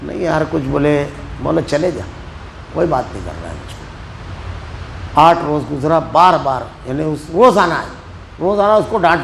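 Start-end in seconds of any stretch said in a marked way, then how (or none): 2.7-5.2 dejected complaint. Retold or imitated. Husband complaining, ignored.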